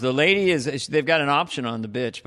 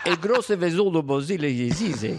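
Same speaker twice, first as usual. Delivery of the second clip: higher mock Russian gibberish; fake Russian; 3 semitones higher than usual. Shown as a demonstration. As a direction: rattle off Russian-sounding names. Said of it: "just say".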